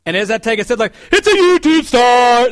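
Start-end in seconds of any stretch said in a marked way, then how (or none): 1.1-2.5 high, loud, drawn-out yell across the train; yelling fan. Retold or imitated. Fans yell on the train about him, high slow shout. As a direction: announce him to the whole car. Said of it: "They're yelling this on the train".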